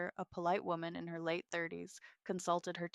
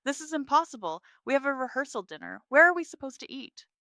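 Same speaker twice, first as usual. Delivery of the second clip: higher, louder, close to tears; panicked and upset, voice climbing; distraught; 8 semitones higher, 9 dB louder, faster than usual. Acted. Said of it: "began to crumple".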